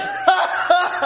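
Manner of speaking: loud, high taunting laugh; a jeering laugh; mocking cackle. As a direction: laugh at them in scorn. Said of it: "I don't think he laughed".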